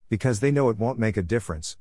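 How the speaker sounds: ordinary delivery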